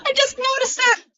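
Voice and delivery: high frustrated yelp; frustrated cry. Real, not acted. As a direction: cry out in annoyance. Frustrated outburst at mistake.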